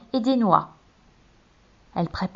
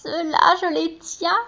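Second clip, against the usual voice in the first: higher, sly honeyed witch voice; higher, sugary witch gloating; 7 semitones higher, slower than usual. A narrator's reading. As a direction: purr it like a greedy witch. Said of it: "d'une voix mielleuse".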